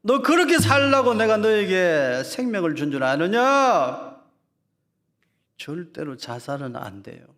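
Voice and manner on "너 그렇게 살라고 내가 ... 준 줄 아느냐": slow, much louder booming voice of God; God's stern question. Acted. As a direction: boom it down slowly like God judging. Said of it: "하나님 질문하실 거예요". (하나님의 엄한 질문)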